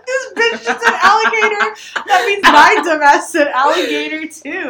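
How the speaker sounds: high, loud, laughing outburst; laughing disbelief. Retold or imitated. Retelling catching her friend's mistake, high laughing outburst. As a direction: crack up while saying it. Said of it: "I said".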